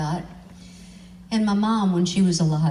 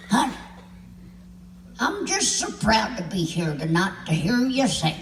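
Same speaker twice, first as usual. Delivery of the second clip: put-on sweet old lady. Old lady praising Pam's singing, sugary gushing.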